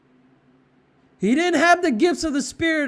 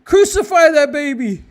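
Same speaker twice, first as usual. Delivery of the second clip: louder chanted yell, stress on crucify; mock mob yell; 2 semitones higher, 6 dB louder than usual. Preacher imitating yelling protesters, loud mock chant. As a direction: bark it like an angry crowd. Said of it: "They're yelling".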